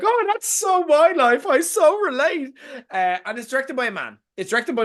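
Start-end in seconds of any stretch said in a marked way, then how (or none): 0.0-2.5 high gushy mock voice of a relating fan; hammed-up gushing fan. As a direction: gush it like a smitten viewer, way up high. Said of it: "go and be like".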